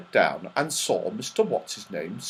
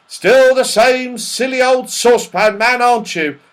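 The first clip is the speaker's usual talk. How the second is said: high, loud, jovial call; crying out; 13 semitones higher, 8 dB louder than usual. Acted. Mister What's-his-name chiding silly Saucepan, crying out. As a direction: call out teasingly to an old friend. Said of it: "cried Mister What's-his-name".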